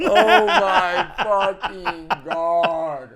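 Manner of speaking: high, slow, drawn out through laughter; laughing. Real, not acted. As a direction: groan it out while cracking up. Laughing at the friend's joke.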